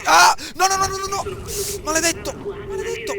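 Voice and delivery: loud, very high frantic no's; panicked cries. Real, not acted. Gamer hit by soldiers, panicked cries. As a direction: cry out in real alarm.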